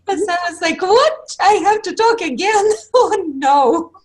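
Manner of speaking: high, loud, giggly protest; laughing mock dismay at being put on the spot; laughing while talking. Real, not acted. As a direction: talk through real laughter, pitch way up. Laughing at being asked to speak again.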